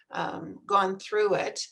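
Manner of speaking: ordinary talk, nothing marked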